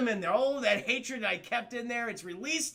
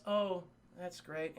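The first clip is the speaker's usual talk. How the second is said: mock dejected; 6 dB quieter, slower than usual. Peter dejected.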